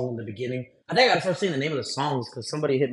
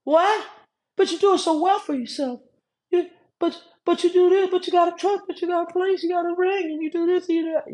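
mock listeners. Listeners reacting to the homeless reveal, in a high astonished squeal. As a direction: squeal it like a stunned fan. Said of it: "Y'all like".

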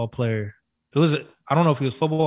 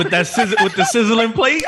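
higher, hammed-up hype; mock chef; 8 semitones higher, 6 dB louder than usual. Friend playing the chef at the table, mock hype. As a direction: announce the plate like a show. Mimicking Salt Bae arriving.

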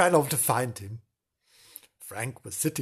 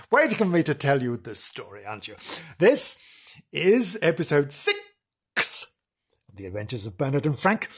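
ordinary delivery

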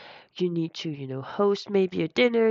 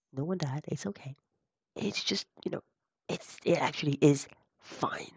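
soft unvoiced whisper; whispered reassurance; 4 dB quieter than usual. Real, not acted. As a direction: whisper it gently.

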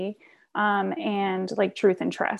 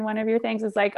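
ordinary talk, nothing marked

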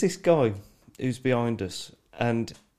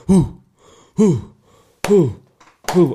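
evenly spaced, loud "hoo"; short chanted hoots with long gaps; Viking clap chant; 4 semitones higher, 8 dB louder, slower than usual. Retold or imitated. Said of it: "but he, he goes".

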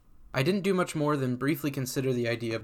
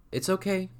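high, soft upper-class British voice; upper-class British accent; 7 semitones higher than usual. A narrator's reading. Lyra reassures the frightened narrator in a soft upper-class British accent. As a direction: voice a high, gentle British lady. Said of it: "says a soft, high voice in an upper-class British accent".

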